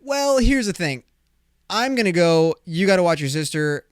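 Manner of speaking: imagined dad. Pretend. Dad lets his kid down, emphatic mock explaining. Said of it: "And I'd be like".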